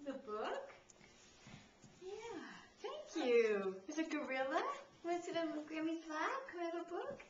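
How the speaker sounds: sing-song picture-book voice for a little one; reading to a baby. Acted. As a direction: read it brightly to a toddler. Grandmother reads picture book to baby.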